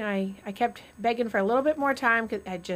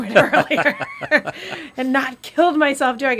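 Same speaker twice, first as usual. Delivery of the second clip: laughing; 5 semitones higher, 7 dB louder than usual. Retold or imitated. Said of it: "my quote was".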